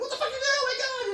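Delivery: high, loud panicked ego blurting out; a shrill inner voice losing it, laughing; panicking ego. Retold or imitated. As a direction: freak out as your own terrified ego. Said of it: "my ego's like".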